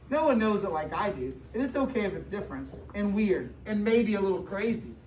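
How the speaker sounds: ordinary delivery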